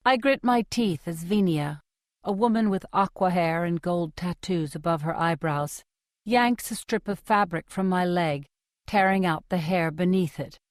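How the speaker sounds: ordinary delivery, nothing marked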